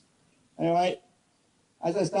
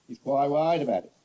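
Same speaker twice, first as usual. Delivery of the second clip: normal talking voice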